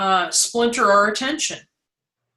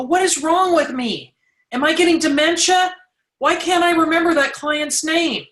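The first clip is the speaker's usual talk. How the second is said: mock panic; 7 semitones higher than usual. Mock self-panic over forgetting.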